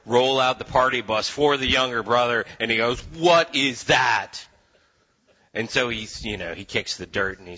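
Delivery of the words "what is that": slow, loud outraged demand; indignant shout. Acted. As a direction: shout it out in disbelief. Older brother sees the party.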